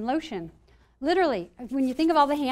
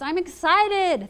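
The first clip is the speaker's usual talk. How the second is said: high, drawn-out "excited"; acted excitement; 6 semitones higher, 4 dB louder, much slower than usual. Speaker corrects the anxious self-talk, high and excited. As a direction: flip nerves into eager excitement. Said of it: "They're excited".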